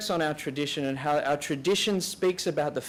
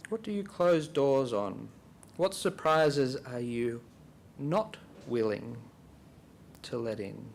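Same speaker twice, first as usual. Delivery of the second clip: guided reflection; 3 semitones lower than usual. Real, not acted. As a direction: pose it gently for silent prayer, softening as you go. Leads silent reflection with questions.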